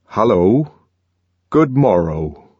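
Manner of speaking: put-on old-fashioned, oddly formal greeting; peculiar English. A narrator's reading. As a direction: greet like from another century. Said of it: "in faultless but peculiar English".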